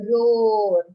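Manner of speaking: teacher's loud lion roar. Shown as a demonstration. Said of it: "You call it".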